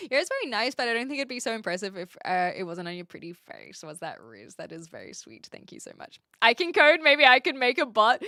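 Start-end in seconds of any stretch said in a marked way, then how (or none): 6.4-8.2 excited. Real, not acted. Excited idea about coding a bot.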